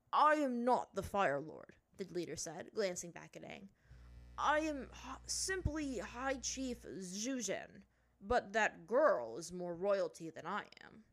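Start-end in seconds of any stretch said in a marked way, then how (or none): 0.1-1.8 stern, loud, weighty chief's voice. Acted. Leader correcting the boy who called him Fire Lord, stern chief. 4.4-11.0 slow, weighty chief's voice. Acted.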